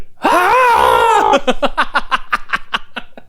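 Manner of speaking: high mock scream breaking into laughter; imitated scream. Movie fan imitating the guy whose face is ripped off, screaming. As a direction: screech like the screaming victim, then laugh. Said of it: "the guy is screaming".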